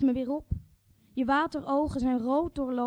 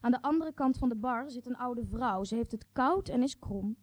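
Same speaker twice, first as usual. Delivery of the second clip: ordinary delivery, nothing marked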